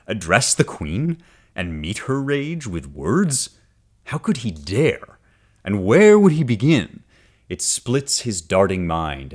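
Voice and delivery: slow, dramatic reading of the verse; poem reading. Acted. Teacher reading Virgil to the class, slow and dramatic. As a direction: let each word of the epic land.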